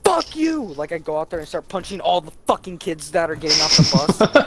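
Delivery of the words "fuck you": high-pitched mock furious yell; hammed-up angry shriek; mock outburst. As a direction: lash out in an over-the-top yell. Acting out his imagined angry outburst.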